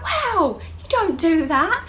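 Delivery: high, loud, drawn-out protest; indignant retort. Retold or imitated. Patient defending herself to Danny, loud high indignation. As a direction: act out outraged self-defence.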